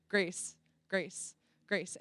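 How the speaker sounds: low, soft, spaced-out words; gently humbling. Retold or imitated. Jesus humbling her before she answers the call, gentle reminder. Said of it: "humbling me and was like".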